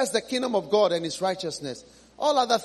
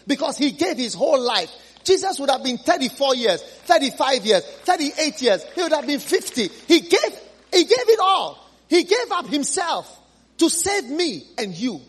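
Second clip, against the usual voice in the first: louder and higher, building to a peak; rising preaching shout; 6 semitones higher, 5 dB louder than usual. Real, not acted. Preacher to congregation, building to a loud high climax. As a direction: pour it out at full force.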